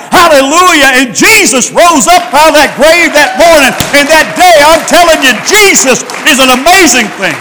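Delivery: loud, fervent preacher climax; preaching. Real, not acted. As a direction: lift the church with a shout. Preaching at full force.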